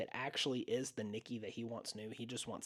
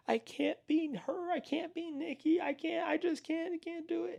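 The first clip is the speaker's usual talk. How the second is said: mock distraught entity voice, pitched way up; imitated sobbing creature plea; entity pleading; 5 dB louder than usual. Entity breaking down, retold scene.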